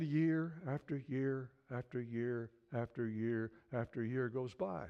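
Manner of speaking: low, slow and measured repetition; weary passage of years. A narrator's reading. Preacher tells of long years waiting, slow and low. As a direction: let each year drag, pitched low.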